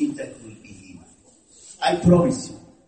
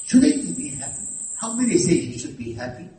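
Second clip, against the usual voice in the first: ordinary talk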